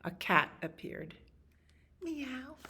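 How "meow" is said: high, soft little meow; cat meow. Acted. Reader voices the cat that appeared, high soft meow. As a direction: mew softly like a small cat.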